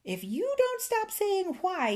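high, heated threat; angry warning. A narrator's reading. Fairy pink with anger warns the old man, angrily. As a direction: pitch it high and indignant. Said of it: "the fairy grew pink with anger".

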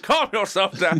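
hammed-up angry telling-off; mock angry man. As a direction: imitate an old-film man losing his temper. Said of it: "the man gets really angry about it".

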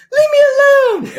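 mock female falsetto, shrieked high; Ian's drunk voice. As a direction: imitate a drunk man squealing like a woman. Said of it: "one of his drunk voices".

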